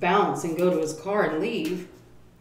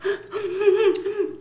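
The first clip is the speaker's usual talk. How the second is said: high helpless laughter. Real, not acted. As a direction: burst out laughing. Laughing at Aaron flying.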